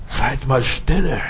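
slow, soft hushing call to silence; calm the congregation into stillness; quiet hush. Shown as a demonstration. Preacher hushing the congregation, slow and soft. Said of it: "Werdet stille".

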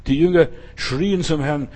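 ordinary delivery

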